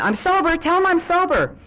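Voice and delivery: very high, loud mock pleading shriek; insistent yelling. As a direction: keep hollering it, frantic to be believed. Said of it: "just on and on".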